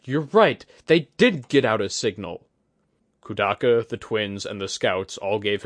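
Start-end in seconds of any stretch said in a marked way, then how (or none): 0.0-2.5 higher and louder, the idea bursting out; excited realization. Acted. Oguri telling the group the signal got out, excited. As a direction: shout it as the plan clicks. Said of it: "a thought came to him suddenly".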